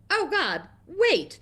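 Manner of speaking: high-pitched retold alarm; urgent exclamation. Shopkeeper reacting to crop circle seekers, urgent exclamation. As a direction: jump up high as if halting everyone.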